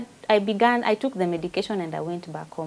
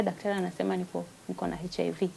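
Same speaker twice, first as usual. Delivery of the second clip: much softer, quick admission; hushed confession; 10 dB quieter, faster than usual. Retold or imitated. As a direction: barely get the words out to your daughter. Confessing diagnosis to daughter.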